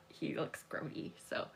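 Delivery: breathy whisper of disgust. Real, not acted. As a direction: hush it, grossed out. Disgusted aside.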